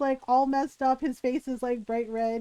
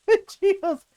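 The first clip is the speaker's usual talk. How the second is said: ordinary delivery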